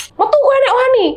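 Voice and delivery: furious Javanese shout into the stairwell; angry challenge. Retold or imitated. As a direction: yell a dare at the unseen ghost. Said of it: "aku bilang gitu".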